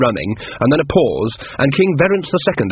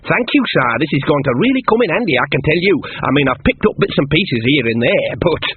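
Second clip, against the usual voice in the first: high, eager thanks settling down; grateful naive Shawn; 3 semitones higher than usual. Acted. Shawn thanks the king for the book, eagerly. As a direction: thank him with innocent enthusiasm.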